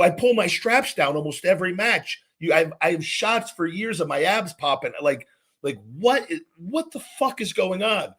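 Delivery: retold indignant protest. Wrestler defending his physique to a backstage colleague, indignant. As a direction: argue it high, slowing on the final incredulous question.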